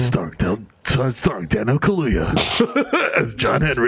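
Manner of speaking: deep movie-trailer credit; low, portentous announcer read; mock epic cast announcement.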